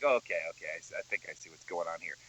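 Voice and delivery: low, soft and fast; quietly figuring it out, rushing; muttering to himself. Retold or imitated. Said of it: "You're like".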